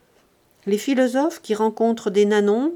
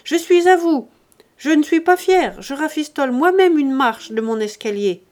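loud, much higher shout; calling out; 6 semitones higher, 7 dB louder than usual. Acted. Grandet shouts a greeting to his friends from the stairs, loud. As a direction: call out to greet the guests. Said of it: "cria Grandet".

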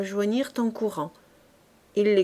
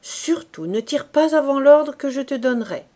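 higher, louder insistent order; firm warning; 5 semitones higher, 6 dB louder than usual. Acted. Corporal leaving Fabrice, loud firm warning. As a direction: drive the point home.

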